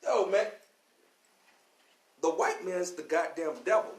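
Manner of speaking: normal talking voice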